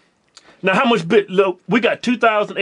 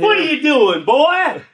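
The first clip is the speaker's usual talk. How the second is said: mock scolding mama; 6 semitones higher, 4 dB louder, slower than usual. Parent voiced, caught son.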